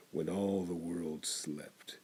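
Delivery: usual speaking voice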